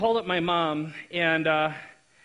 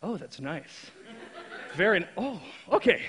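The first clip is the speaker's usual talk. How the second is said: creepy. Pretend. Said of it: "was that creepy".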